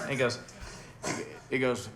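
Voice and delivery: ordinary delivery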